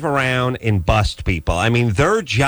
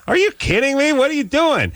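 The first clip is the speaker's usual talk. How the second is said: incredulous; 13 semitones higher than usual. Retold or imitated. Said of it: "And I'm like".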